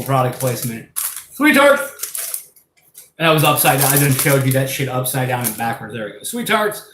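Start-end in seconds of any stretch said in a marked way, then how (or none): 1.3-2.5 high, loud TV-commercial shout; mock ad pitch. Showing candy to viewers as a mock commercial, high and loud. Shameless product placement pitch. 6.2-6.8 mock ad pitch. Product placement pitch again.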